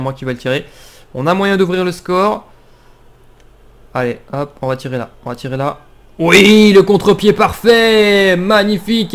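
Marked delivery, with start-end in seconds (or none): 6.2-9.1 loud, slow goal cheer; excited shout. Real, not acted. Penalty scored.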